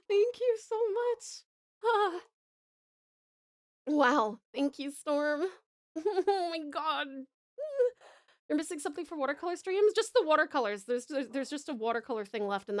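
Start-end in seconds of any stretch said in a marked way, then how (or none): none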